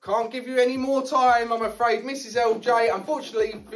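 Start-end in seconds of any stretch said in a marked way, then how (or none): none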